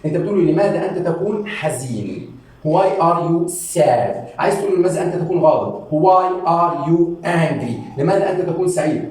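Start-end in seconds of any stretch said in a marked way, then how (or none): 2.7-4.4 very slow, stretched-out question; teacher's word-by-word demonstration; slow modelling. Shown as a demonstration. 5.9-8.0 very slow, stretched-out question; teacher's word-by-word demonstration; slow modelling. Shown as a demonstration.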